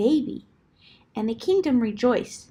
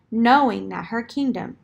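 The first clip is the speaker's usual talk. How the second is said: plain reading voice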